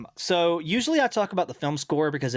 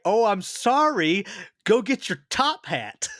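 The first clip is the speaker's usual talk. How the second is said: slow, high-pitched sarcasm; mock apology; 7 semitones higher, 3 dB louder, slower than usual. Mocking her friend over pajamas, sarcastic. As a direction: drag out a louder, hammed-up sarcastic comeback.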